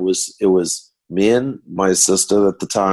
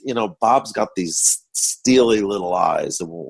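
usual speaking voice

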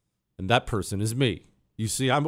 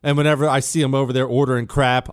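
ordinary delivery

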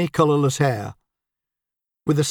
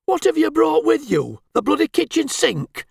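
much higher, incredulous jibe; mocking disbelief; 11 semitones higher than usual. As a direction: ridicule how much she packed. Husband mocks wife's luggage.

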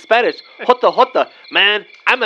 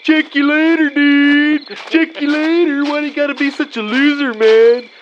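higher, louder put-on movie slacker voice; movie quote voice; 7 semitones higher, 4 dB louder than usual. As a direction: do the stoner from the film, sneering. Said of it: "that's like off the scene off of Days Confused".

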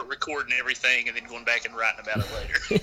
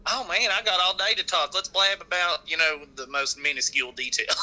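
higher-pitched folksy chatterer with all day; small-town talker; 5 semitones higher than usual. Acted. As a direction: play an unhurried country blabbermouth. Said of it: "people in a small town, they have more time".

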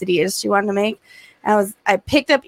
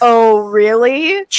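mock challenge; 6 dB louder, much slower than usual. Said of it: "And I was like".

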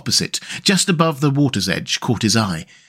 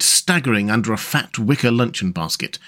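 plain reading voice

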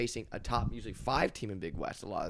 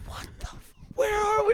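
a breathless "What the f-" jumping to a much higher "where are we?"; shocked disbelief; 4 dB louder than usual. Retold or imitated. Player recalling the long drive to the rural campus, stunned high-pitched disbelief. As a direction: act out staring at endless empty farmland, stunned. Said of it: "I was like".

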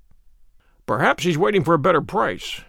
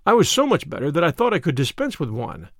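usual speaking voice

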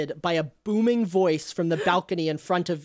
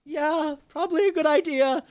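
higher, agreeable NPC voice; Andera voiced; 10 semitones higher than usual. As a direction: play Andera giving in. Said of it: "And she says".

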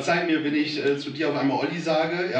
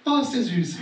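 teasing, laughing coo; cooing "so cute"; 6 semitones higher than usual. Shown as a demonstration. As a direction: coo it like admiring a baby. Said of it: "Das könnt ihr auch laut sagen". (neckisch gurrend)

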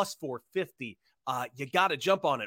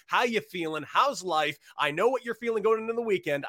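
normal talking voice

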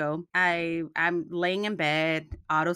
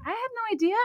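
much higher, mock innocent husband; clueless husband; much faster than usual. Wife mimics husband who forgot the night, mock innocent. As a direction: squeak it out as the oblivious husband. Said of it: "He's like".

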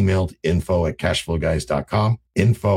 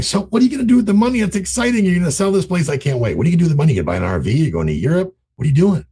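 much higher, fast, bubbling with excitement; excited and upbeat; 10 semitones higher, 3 dB louder, faster than usual. Retold or imitated. Coaching real estate investors, buyer gushes over the seller's plans with excitement. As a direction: gush like you can't wait to hear. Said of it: "if you come in going".

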